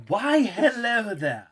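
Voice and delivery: high, slow, hammed-up posh English; exaggerated posh English, drawn out and lilting high; prim upper English accent. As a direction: greet them in a mock upper-class English voice. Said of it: "let's do prim upper English".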